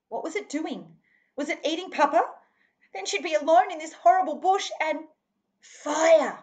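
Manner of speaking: high, loud, rushing with fear; a frightened girl near tears; rising panic. A narrator's reading. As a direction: panic building toward the cry of fire. Panicked thoughts of lost girl.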